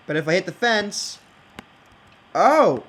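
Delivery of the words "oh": sudden loud startled oh; surprised oh. Real, not acted. Player startled when the pressure plate fires, loud surprised oh. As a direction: yelp it as the trap fires. Contraption triggers again.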